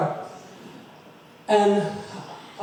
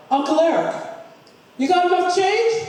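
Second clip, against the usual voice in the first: calling out; 11 semitones higher than usual. Retold or imitated. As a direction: shout it out the window to him. Calling out the car window.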